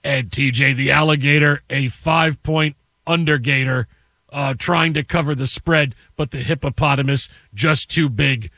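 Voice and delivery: deadpan mock broadcast; mock play-by-play. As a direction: call the fight like a live game. Mock play-by-play of animal fight.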